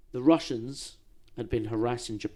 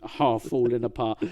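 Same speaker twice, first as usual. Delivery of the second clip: ordinary talk, nothing marked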